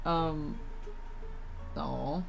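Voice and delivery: normal talking voice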